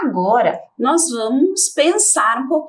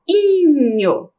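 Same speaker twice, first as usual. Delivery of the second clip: inho said alone, clearly and a bit louder; isolated ending; 4 semitones higher, 3 dB louder than usual. Shown as a demonstration. Teacher isolates the sound inho for the class. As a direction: give inho on its own, clearly. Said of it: "Olha o som final".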